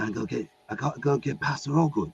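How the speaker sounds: lightly voiced British tough guy; a hint of a British action actor; action-hero voice. Gabriel explains his errand to Michael, light British action-hero voice. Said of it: "I put on the voice".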